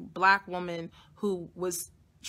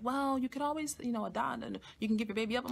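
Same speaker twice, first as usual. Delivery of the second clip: higher, softer imitation of a clinic worker; lightly voiced advisor; 4 semitones higher, 7 dB quieter, faster than usual. Clinic worker offering options, lightly imitated soft high voice. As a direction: suggest it softly in a lightly put-on higher voice. Voicing health center worker suggesting adoption.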